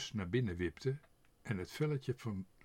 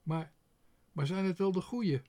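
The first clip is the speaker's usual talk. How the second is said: put-on high, hesitant girl's voice; doubtful girl; 8 semitones higher, 5 dB louder than usual. A narrator's reading. Otje asks her father if they are right, high doubtful voice. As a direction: ask anxiously as a young girl.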